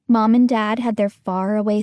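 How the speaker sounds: ordinary delivery, nothing marked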